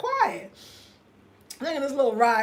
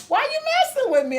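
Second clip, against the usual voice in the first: higher, fast, indignant; mad; 13 semitones higher, 3 dB louder, much faster than usual. Retold or imitated. Retelling snapping at Kwame for waking her. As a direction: fire it back fast and high. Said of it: "I was so mad".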